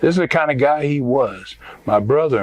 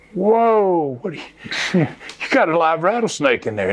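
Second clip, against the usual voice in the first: retold amazement; 6 semitones higher than usual. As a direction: act out being taken aback. Retells his shocked reaction to friend.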